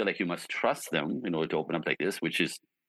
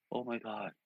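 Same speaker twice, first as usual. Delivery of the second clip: hushed oh my god; 4 semitones higher, 10 dB quieter than usual. Retold or imitated. Client about to recount the date.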